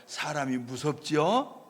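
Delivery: usual speaking voice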